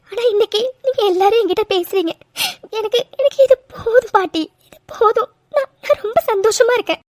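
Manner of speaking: high, tearful voice, choked with gratitude; tearful happiness. Acted. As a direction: cry through the joy, halting on repeated words. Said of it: "அழாதடா, அழாத". (மகிழ்ச்சியில் அழுகை கலந்த குரல்)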